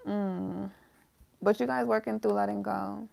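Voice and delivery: normal talking voice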